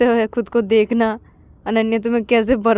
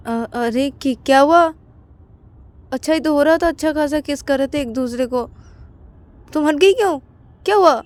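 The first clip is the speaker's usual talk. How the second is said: higher, whiny puzzled asking; puzzled lover; 7 semitones higher, 3 dB louder than usual. Acted. Ananya asking Payal why she stopped kissing.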